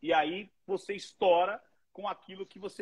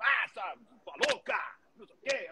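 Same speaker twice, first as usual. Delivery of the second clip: mock teasing insult; 7 semitones higher than usual.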